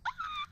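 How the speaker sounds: very high, short squeal; shocked shriek. Retold or imitated. Watching drunk friend punch Meredith, shocked shriek.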